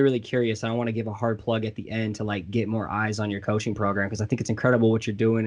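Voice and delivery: ordinary talk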